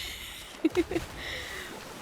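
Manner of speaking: high breathy giggle at herself; sheepish airy giggle; embarrassed laugh. Real, not acted. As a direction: laugh off the bad accent. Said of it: "Maybe pretend I never did that".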